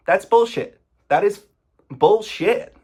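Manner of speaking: loud, high indignant call-out; furious rant, punching bullshit; angry outburst. Real, not acted. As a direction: spit it out, fed up. Furious at the claim.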